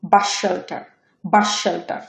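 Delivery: বাস said "bash", merging into শেল্টার; one long sh across বাস শেল্টার; assimilated S. Shown as a demonstration. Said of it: "ওটা উচ্চারণ হবে".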